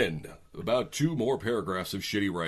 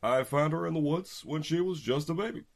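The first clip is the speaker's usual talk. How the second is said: ordinary delivery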